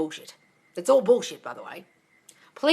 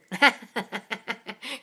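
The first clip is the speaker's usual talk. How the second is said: real laugh. Real, not acted. Joking with viewers about staring at them, laughing. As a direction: burst of amused laughter. Amused by own joke.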